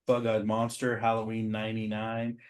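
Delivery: ordinary delivery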